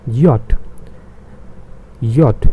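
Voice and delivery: Yacht said as "yot", crisp final t; Yacht as the German name of J; German letter J. Shown as a demonstration. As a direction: sound out Yacht as "yot", each one apart. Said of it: "That's why I've written it".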